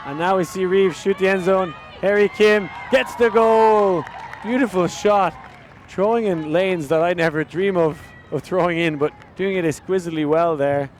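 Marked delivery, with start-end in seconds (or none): 0.2-6.2 excited. Real, not acted. Goal scored, commentator excited.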